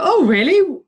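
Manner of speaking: high, lightly acted surprised reaction; mock surprise. Voicing a fellow passenger hearing the pitch, mock surprise.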